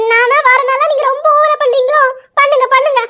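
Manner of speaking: piqued wife's retort; huffy indignation. Acted. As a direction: dare him to carry on, offended. Wife sulks and goads husband.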